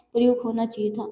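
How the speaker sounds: ordinary talk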